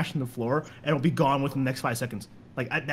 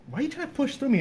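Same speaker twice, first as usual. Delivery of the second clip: indignant question; 8 semitones higher, faster than usual. Retold or imitated. Retold confrontation, high pitch.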